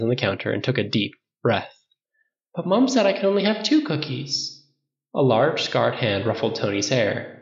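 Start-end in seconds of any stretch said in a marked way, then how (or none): 2.5-4.7 put-on high-pitched child's protest; little boy. A narrator's reading. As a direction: plead like a small child. Young Tony talking to his father.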